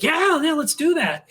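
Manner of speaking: high-pitched, enthusiastic retold yes; imitated eager agreement. Writer retells her answer to the accent idea, imitated enthusiasm. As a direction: imitate someone jumping at an idea. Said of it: "And she said".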